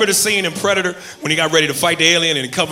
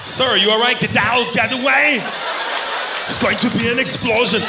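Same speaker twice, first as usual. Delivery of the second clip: urgent Austrian action-hero shout; high, strained alarm in thick accent; Arnold Schwarzenegger impression; 5 semitones higher than usual. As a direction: yell orders like a movie commando. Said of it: "I don't know why he says that".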